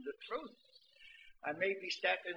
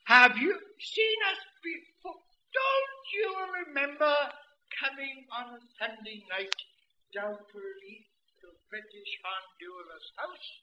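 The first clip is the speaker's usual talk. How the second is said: put-on high, loud astonished old man; an old man amazed he's forgotten; loud high old man fading to plain; 4 semitones higher, 7 dB louder than usual. Old man voiced in story.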